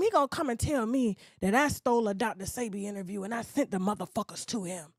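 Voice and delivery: ordinary talk, nothing marked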